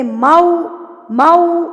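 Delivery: मऊ gliding from a to oo, lips fully round; rounded mau. Shown as a demonstration. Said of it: "होंठों को बिल्कुल गोल कर लें".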